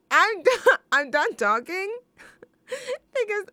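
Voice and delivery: high and loud, laughing through the words, slowing down; helpless laughter. Real, not acted. Host to listeners, overcome with embarrassed laughter. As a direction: giggle until words barely come out.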